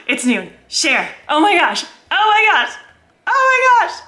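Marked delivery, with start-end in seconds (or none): 1.3-4.0 repeated squeals climbing higher, ending in a scream; freaking out. Real, not acted. Vlogger as the launch goes live, freaking out. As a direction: build from excited to a high piercing squeal. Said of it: "I'm freaking out".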